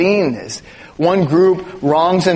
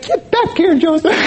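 much higher, mock scolding call; imitating angry wife; 12 semitones higher than usual. Husband imitating his wife ordering him back, high and scolding. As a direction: play the mad wife calling him back. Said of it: "she said".

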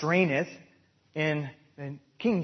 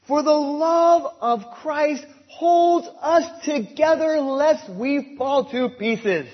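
full-force preaching; 7 semitones higher, 6 dB louder than usual. Acted. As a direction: proclaim it to the congregation.

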